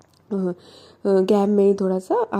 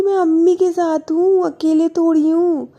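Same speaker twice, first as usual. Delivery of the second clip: much higher and louder protest; exasperated retort; 7 semitones higher, 6 dB louder than usual. Acted. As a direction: snap back in disbelief. (बहुत ऊँची और ज़ोरदार झुँझलाहट)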